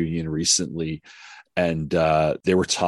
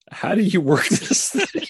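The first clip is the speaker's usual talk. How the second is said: higher, puzzled put-on voice; baffled with camera; 12 semitones higher than usual. Cast members fumbling with the camera, mock-baffled and higher. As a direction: play it clueless, poking at a camera. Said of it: "they're like".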